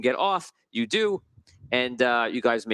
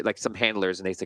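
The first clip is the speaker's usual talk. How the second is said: ordinary delivery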